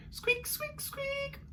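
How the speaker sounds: tiny mouse squeak for fun; squeaky Artie, high and soft. Shown as a demonstration. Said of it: "let's copy after him".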